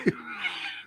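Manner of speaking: sudden high burst of laughter. Real, not acted. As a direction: laugh out sharply. Laugh after swearing.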